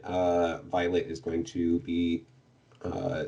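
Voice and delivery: ordinary talk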